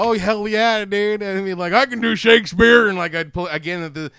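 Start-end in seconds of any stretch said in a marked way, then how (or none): 0.0-2.9 loud, raised showman's brag; excited boasting. Acted. Would-be kaiju prop comic answering, excited boast. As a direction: proclaim it with big hammy gusto.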